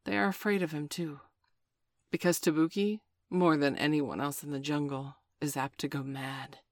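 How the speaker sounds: ordinary delivery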